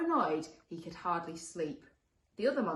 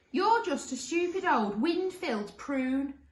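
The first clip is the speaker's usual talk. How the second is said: high, slow, loud taunt; high-pitched monster name-calling; shouted monster insult; 9 semitones higher, 7 dB louder, slower than usual. Acted. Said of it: "shouted the first monster".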